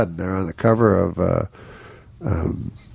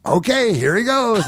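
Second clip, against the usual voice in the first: a much higher mock announcer, slowing at the name; announcing the reveal; 13 semitones higher than usual. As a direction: ham up the organizer's big unveiling. Organizer hands over the stage.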